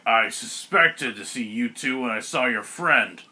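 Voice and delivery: deliberate, drawn-out villain delivery; Ogremon villain. Acted. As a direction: voice a dazed ogre, measured and low.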